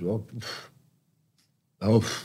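mock French puff and sigh; dismissive French-style sigh; French dismissiveness. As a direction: imitate a French brush-off with a puffed "oh". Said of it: "That's so French".

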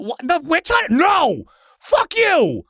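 very high, outraged holler; indignant refusal. Acted. Spirit refuses to kill for Cordelia, indignant.

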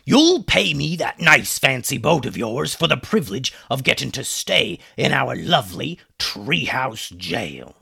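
sneering villain pirate; gloating pirate captain. A narrator's reading. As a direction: gloat over the prisoners as a pirate.

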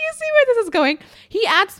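high, laughing squeal. Real, not acted. Laughing aside to viewers.

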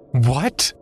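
sharp high scream of outrage. A narrator's reading. Narrator screaming at the stranger, a high furious shout. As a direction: shriek it, furious. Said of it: "I screamed".

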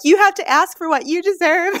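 high, loud, insistent encouragement; retold pep talk.

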